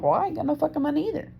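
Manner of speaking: high, quick exasperated retort; exasperated comeback. Retold or imitated. Speaker retelling money row with partner, exasperated.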